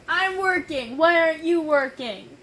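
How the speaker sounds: annoyed scolding. Retold or imitated. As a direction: snap at a lazy sister. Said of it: "It's more like".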